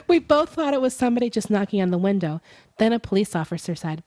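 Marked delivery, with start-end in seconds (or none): none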